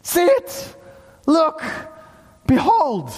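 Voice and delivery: high, separate emphatic calls; urgent exclamation. Acted. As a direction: urge each word out with a pause between. Preacher urging people to look.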